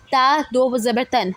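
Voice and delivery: ordinary delivery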